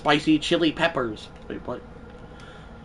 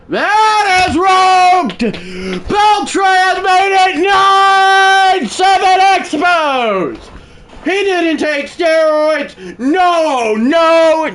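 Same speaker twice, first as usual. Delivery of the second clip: booming, slow, drawn-out announcer call; home run call; 9 dB louder, much slower than usual. Acted. Mock play-by-play home run call.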